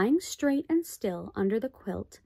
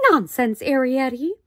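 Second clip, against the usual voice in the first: louder, sharp scolding; sharp exclamation; 4 semitones higher, 7 dB louder than usual. A narrator's reading. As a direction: snap at the child. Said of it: "exclaimed Homley sharply".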